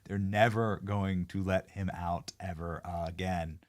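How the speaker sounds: low, slow, measured verdict; slow, deliberate. Real, not acted. Host repeating a verdict on Joe, slow and deliberate. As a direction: spell it out slowly and quietly.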